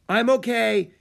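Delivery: high, loud reassuring call; shout. A narrator's reading. Girl answers from behind a boulder after rockslide, shouting. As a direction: shout back that you're safe. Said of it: "Annie shouted".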